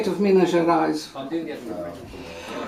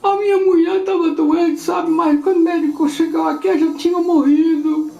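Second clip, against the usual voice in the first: higher, tearful-toned quote; lightly voiced grieving man; 9 semitones higher, 4 dB louder than usual. Old man explaining his wife died, lightly acted grief. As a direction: tell it like a bereaved old man. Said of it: "So he said".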